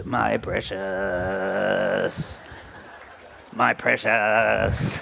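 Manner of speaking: very slow, drawn-out creature croon; mock Gollum crooning over a treasure. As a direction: stretch every syllable like a greedy cave creature. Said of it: "you remember Lord of the Rings".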